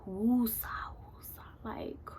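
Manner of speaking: whispered, breath-heavy self-soothing; hushed mock relaxation mantra; calming breaths. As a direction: whisper it as you try to calm yourself. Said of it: "breathing, trying to breathe".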